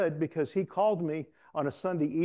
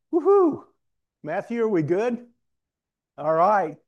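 loud high whoop then call across the room; 6 semitones higher, 8 dB louder than usual. Real, not acted. Preacher calls out to Matthew across the room, loud and high. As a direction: cheer out to Matthew, full voice. Calling out mid-sermon.

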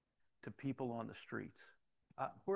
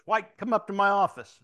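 loud, high imitation of a commanding captain; barked summons; 7 semitones higher, 15 dB louder than usual. Captain calling officer to his office, barked summons. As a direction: call out an order at full volume.

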